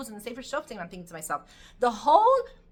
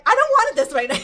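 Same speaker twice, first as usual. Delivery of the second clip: much higher, much louder exasperated outburst; exasperated son; 11 semitones higher, 14 dB louder than usual. Retold or imitated. Son fed up with everyone's advice, loud exasperated outburst. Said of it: "and he's like".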